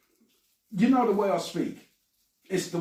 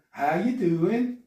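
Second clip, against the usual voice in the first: slow, botched American accent; attempted American accent; 2 semitones higher, 4 dB louder, slower than usual. Shown as a demonstration. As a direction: drag out a badly faked American greeting. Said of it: "we talked about that accent".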